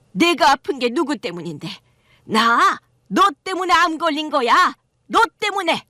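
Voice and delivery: mother-in-law blaming her son's wife for her cancer; accusing mother-in-law. Acted.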